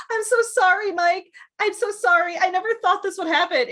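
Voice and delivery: gushing mock apology, rushed at the end; mock pleading heroine. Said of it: "and she's like".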